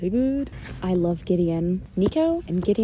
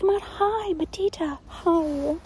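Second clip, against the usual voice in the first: imitating moms; 9 semitones higher, much slower than usual. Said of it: "They're like".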